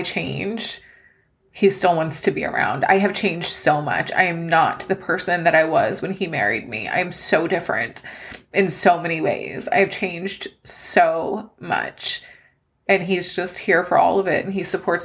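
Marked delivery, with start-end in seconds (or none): none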